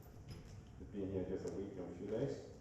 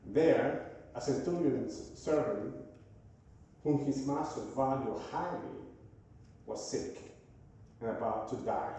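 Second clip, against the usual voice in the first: louder, earnest reading of the passage; scripture reading; 4 semitones higher, 5 dB louder, slower than usual. Acted. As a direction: proclaim the Gospel text to the congregation. Reads scripture aloud to congregation.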